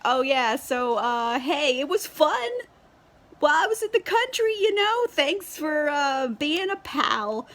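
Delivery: high, airy fake-casual guy voice; mock him as a cheery fuckboy brushing her off; lilting high mock-friendly sign-off; mocking breezy dude. Willoughby's breezy letter, mocked.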